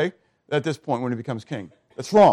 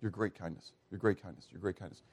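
soft, much quieter, whispered-like awe; hushed repetition; 7 semitones lower, 16 dB quieter than usual. Acted. Solomon repeating God's kindness in hushed awe. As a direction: let it fall away to almost nothing. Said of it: "he says it twice".

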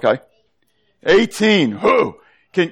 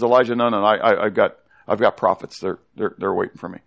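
voice of God; 8 semitones lower than usual. Retold or imitated. God reassures worried Elijah he is not alone, low and gentle. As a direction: speak as God, low and soothing, trailing off.

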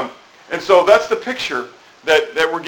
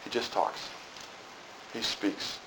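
near-whisper, much softer; still small voice; 5 semitones lower, 21 dB quieter than usual. Shown as a demonstration. Preacher showing God's still small voice, whispered. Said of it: "small voice".

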